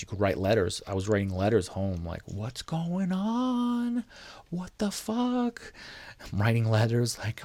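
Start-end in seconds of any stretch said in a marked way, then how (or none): 2.3-5.7 high, drawn-out mock wail; exasperated wail. Inmate writing letters home from jail, high stretched-out wail. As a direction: wail it slowly, far above your usual pitch.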